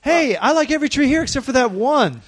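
put-on high kid voice; bratty kid. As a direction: sing out like an eager kid at the tree lot. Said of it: "kid at tree lot".

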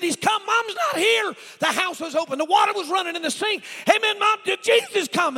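frantic high, full-force shouting; panicked screaming. Retold or imitated. Boy explaining the empty house to neighbour, panicked screaming. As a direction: pour it out at the top of the voice. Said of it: "What are you screaming?".

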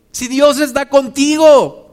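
high, loud, slow proclamation; booming sermon declaration; loud preached climax. Acted. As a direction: ring it out to the rafters.

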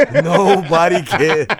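slow, laughing repeat of the punchline. Acted. Shout-out to jailed brother, laughing at his own punchline. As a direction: laugh through it, drawn out. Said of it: "repeats punchline laughing slowly".

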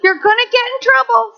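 put-on child scolding her hands. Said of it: "used to yell at her hands".

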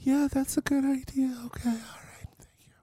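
high whisper. Real, not acted. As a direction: hush it like a church mouse. Whispered aside after church mouse.

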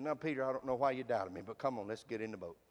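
low, soft, tender reassurance; gentle Jesus. Retold or imitated. Jesus lifting Peter from the water, low and soft. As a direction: coax him back like a patient friend. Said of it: "And he said".

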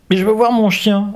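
high, loud pleading demand; insistent. A narrator's reading. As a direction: insist like an upset girl. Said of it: "insiste Claude".

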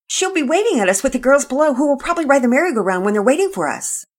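put-on high, fast girl's voice rushing on; fast, high and breathless; talking fast. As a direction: rattle it off without a breath. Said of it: "talking so fast she began stumbling".

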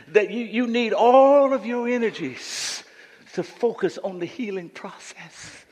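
usual speaking voice

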